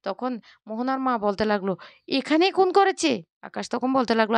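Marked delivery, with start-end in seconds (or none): 2.1-3.1 a sudden high, shocked gasp of a question. A narrator's reading. Mother hearing of a murder, shocked and high. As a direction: jump up in disbelief.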